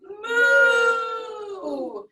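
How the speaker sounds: a high, loud, playful moo; cow moo. Shown as a demonstration.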